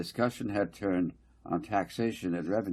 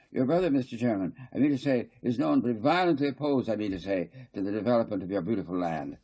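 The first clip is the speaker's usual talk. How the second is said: higher, louder, insistent council speaker; fussy councillor Garth; 4 semitones higher, 3 dB louder, faster than usual. Acted. Garth lecturing the council, higher and insistent. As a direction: press the point, repeating I mean to say.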